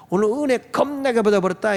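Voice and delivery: imitated Jeolla churchgoer with a trailing "ing"; Jeolla dialect. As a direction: retell it in a Jeolla regional lilt. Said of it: "처음 전라도 갔을 때".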